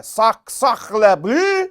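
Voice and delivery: loud, high, drawn-out French exclamation; hammed-up French indignation, stretched out and loud; mock French outrage. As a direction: blurt it in an over-the-top French accent. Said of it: "the French people would have been like".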